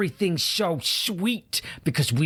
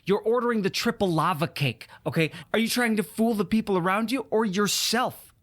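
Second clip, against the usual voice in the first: ordinary talk, nothing marked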